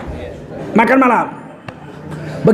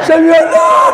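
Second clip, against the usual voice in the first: mock loud prayer call; 5 semitones higher, 3 dB louder than usual.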